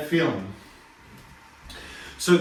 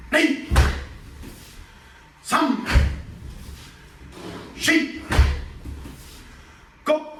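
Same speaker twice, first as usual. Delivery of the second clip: counting in Japanese; 10 semitones higher, much slower than usual. Shown as a demonstration. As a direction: call each count sharply, high. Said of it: "let's do ten more".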